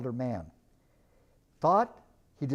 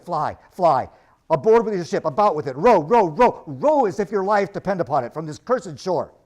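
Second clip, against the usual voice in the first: frantic warning; 7 dB louder than usual. Acted. Terrified stranger urges flight.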